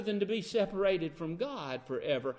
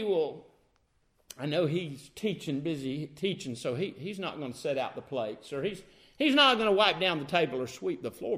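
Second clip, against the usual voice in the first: grumbling; 5 semitones lower, 5 dB quieter than usual. Acted. Martha's imagined inner grumble.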